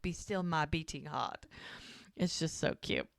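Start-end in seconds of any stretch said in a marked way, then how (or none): 0.0-1.5 soft, lightly put-on English accent; hint of old English accent, trailing softer; mock medieval English accent. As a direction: imitate his courtly English accent, softly. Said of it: "he does it with the accent".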